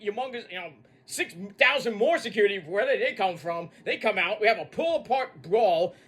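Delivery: ordinary delivery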